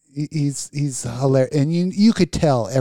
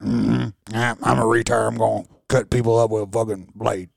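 lower, put-on movie killer voice; Sling Blade; 6 semitones lower, 4 dB quieter than usual. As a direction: do a low character impression. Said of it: "Like Sling Blade".

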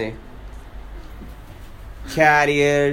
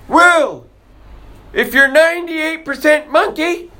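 mocking the scientists; 11 semitones higher than usual. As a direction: mimic them as pompous and silly. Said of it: "Now they wanna say".

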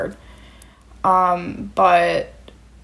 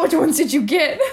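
laughing; 8 semitones higher than usual. Pretend. Joking future grandparents voice.

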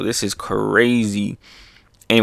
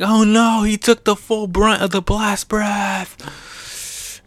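high, drawn-out mock dismay; hammed-up worried cry; alarmed onlooker; 10 semitones higher, slower than usual. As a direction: wail it in a put-on high voice. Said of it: "Yamato's like".

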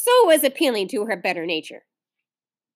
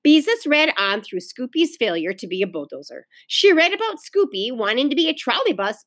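ordinary delivery

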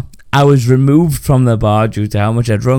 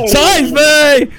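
mock cry for help; 14 semitones higher, 4 dB louder than usual. Acting out drowning.

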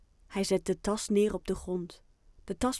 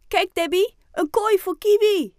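high, loud, eager exclamation; excited child; 12 semitones higher, 12 dB louder than usual. Acted. Boy showing the teacher his cage, excited call. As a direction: show it off at full pitch.

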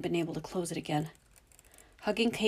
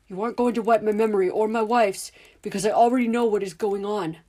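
louder, exasperated insistence; angry, raised voice; 4 semitones higher, 9 dB louder than usual. Acted. Father refusing the memory wipe, raising his voice. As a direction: push back hard, losing patience. Dudley's patience running out.

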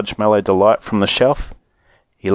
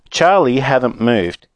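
ordinary delivery, nothing marked

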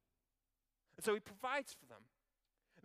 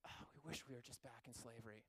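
softer, lower sarcastic sigh; mock wistful sigh; 7 semitones lower, 14 dB quieter than usual. Preacher mocking Israelites longing for slavery, soft mock sigh.

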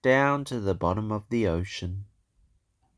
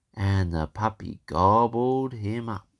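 ordinary delivery

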